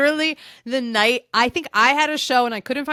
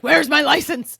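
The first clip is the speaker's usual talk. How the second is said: mock panic; 6 semitones higher, 7 dB louder than usual. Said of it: "I was like".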